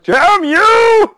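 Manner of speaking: cartoon villain shout at the dock owner; mock curse. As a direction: yell it as you speed away. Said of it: "you're just like".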